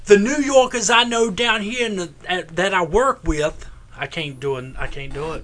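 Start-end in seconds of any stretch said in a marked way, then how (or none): 0.1-3.6 badly faked New York accent, pitched up; terrible New York accent. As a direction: botch a New Yorker accent with a Southern twang. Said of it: "that was a terrible New Yorker accent".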